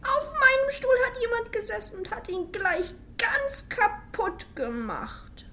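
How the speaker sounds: very high, loud squeaky wail, slowing at the end; weeping Baby Bear. A narrator's reading. Baby Bear finds his chair broken, squeaky crying. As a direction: wail it like a heartbroken little cub. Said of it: "weinte Baby Bär".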